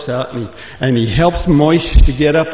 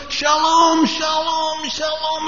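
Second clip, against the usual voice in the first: very high, frantic repeated cries; panicked shout; 13 semitones higher, much slower than usual. Acted. Moshe sees the cliff coming, very high frantic cries. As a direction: yank the reins in terror.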